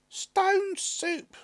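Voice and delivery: high, loud woman answering; high and proud; woman's voice. A narrator's reading. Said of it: "replied the woman and the traveller".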